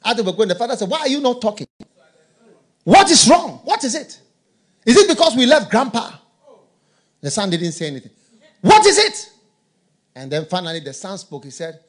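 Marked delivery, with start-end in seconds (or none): 0.9-6.1 higher, louder anxious questions; worried father questioning. Retold or imitated. Father asking silent son in car, loud worried questions. 8.6-9.3 high and loud with a laugh; pressing demand. Retold or imitated. Father demanding answer from son, loud laughing.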